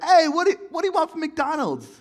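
high, loud call from the front seat; dad yelling out. Retold or imitated. Dad calling back to his kids from the driver's seat, loud yell. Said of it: "my dad would yell out".

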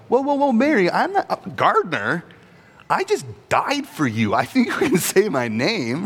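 high, laughing, gently teasing; chuckling, lightly correcting Mary; amused Jesus. Retold or imitated. Jesus teasing Mary about the gardener mix-up, amused and laughing. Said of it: "does not take it as a threat of".

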